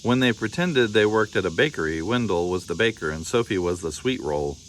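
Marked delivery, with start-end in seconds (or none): none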